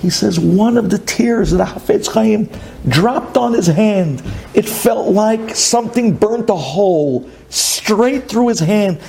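ordinary delivery

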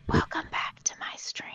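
whispered streamer greeting; breathy, voiceless streamer whisper; imitating ASMR whisper. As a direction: whisper a mock welcome to viewers. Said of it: "But, like, whispering".

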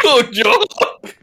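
high disgusted squeal; silly high voice. Acted. Silly reply to quacking.

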